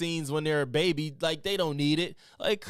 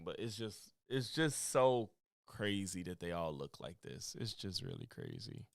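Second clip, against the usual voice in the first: muttered disbelief; 8 semitones lower, 9 dB quieter than usual. Real, not acted. Muttering disbelief under breath.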